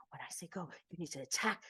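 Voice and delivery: softly hushed pep talk; imitated whisper. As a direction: murmur it close like a coach. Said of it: "he's like".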